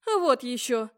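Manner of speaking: usual speaking voice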